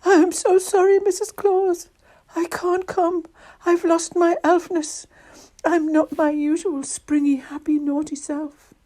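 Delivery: high-pitched, sorrowful little elf; unrecognizable elf voice. A narrator's reading. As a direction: apologize in a high, miserable elf voice. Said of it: "in a voice that Missus Claus didn't recognize".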